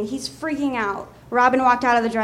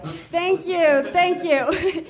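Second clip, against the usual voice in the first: higher, stronger Doctor Phil thank-you; retry the impression with more lift; host thanking the cheering audience, higher and more performed; Doctor Phil take two; 5 semitones higher, much slower than usual. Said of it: "I can't do an, an impression, sorry".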